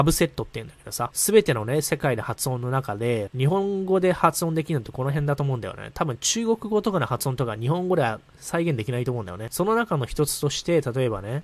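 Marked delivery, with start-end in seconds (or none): none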